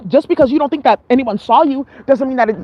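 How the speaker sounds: ordinary talk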